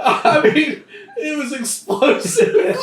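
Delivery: high, laughing explanation; real laughing. Real, not acted. Player explains the explosive accident to the table, cracking up. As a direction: squeeze the words out through laughter.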